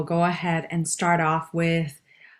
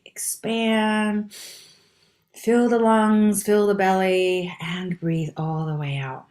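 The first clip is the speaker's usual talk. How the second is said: guided breathing; 3 semitones higher, slower than usual. Acted. Instructor counting listeners through a full breath, slow guided breathing. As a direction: draw each instruction out like a long breath.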